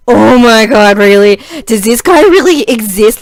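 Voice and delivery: loud, acted-out amazement; exasperated disbelief. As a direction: exclaim it as if you cannot believe it. Re-enacts her own amazed disbelief.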